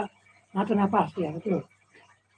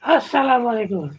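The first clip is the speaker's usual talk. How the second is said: normal talking voice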